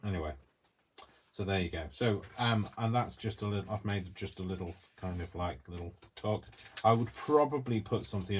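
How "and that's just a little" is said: usual speaking voice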